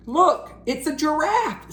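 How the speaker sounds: high excited pointing. Acted. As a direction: spot it and point. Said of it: "said Plank".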